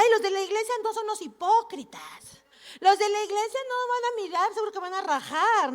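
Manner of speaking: queja aguda y burlona; queja despectiva imitada. Retold or imitated. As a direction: imita un desprecio quejoso, con tono más alto. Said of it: "entonces decía". (desdén burlón en voz aguda)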